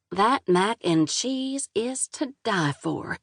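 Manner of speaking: Southern waitress raves about the mac and cheese; slow Southern drawl. Acted. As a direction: savour the praise in a Southern drawl. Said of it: "thick southern drawl".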